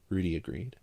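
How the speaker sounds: ordinary delivery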